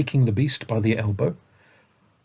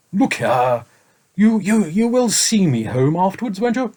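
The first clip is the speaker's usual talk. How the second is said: raised, emphatic sleepy dragon voice; impressive dragon; 8 semitones higher, 5 dB louder than usual. A narrator's reading. Dragon pressing the boy at the banquet, impressive. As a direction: lean in and say it with weight. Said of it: "said impressively".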